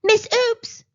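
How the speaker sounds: loud, high call of the name; a dentist rallying his assistant; crying out. Acted. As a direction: cry out with sudden resolve. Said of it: "he cried".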